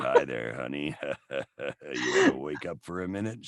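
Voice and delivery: low, leering New York creep voice; New York pervert. Retold or imitated. Waking his wife at night in a low New York pervert voice. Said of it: "my New York pervert".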